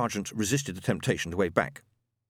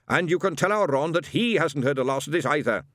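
muffled from hallway; 6 semitones higher, 4 dB louder than usual. Acted. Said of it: "muffled voice echoed from the hallway".

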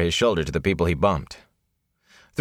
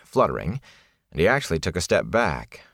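ordinary delivery, nothing marked

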